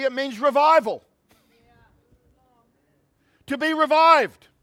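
high, loud revival preacher; fervent preaching. Real, not acted. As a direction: proclaim it with rousing, punched key words. Preaches with rising force.

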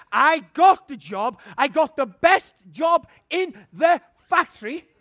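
high, loud victorious cry; triumphant shout. Real, not acted. Preacher telling the congregation he got the job, shouting. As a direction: crow it in triumph.

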